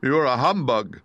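a touch of wounded reproach; grieved. Acted. Scarecrow naming the wizard a fraud, grieved. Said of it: "in a grieved tone".

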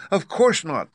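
put-on high-pitched, loud girl's reply; Dorothy. A narrator's reading. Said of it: "answered Dorothy".

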